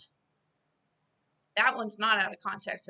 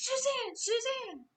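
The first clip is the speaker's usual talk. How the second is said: screaming a name; 13 semitones higher, slower than usual. Retold or imitated. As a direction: cry her name out urgently from far above. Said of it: "that voice that was screaming those things".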